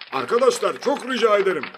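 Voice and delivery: high, loud appeal to a crowd; exasperated plea. A narrator's reading. Prime minister begs friends to stop clapping, exasperated. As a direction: plead over the applause.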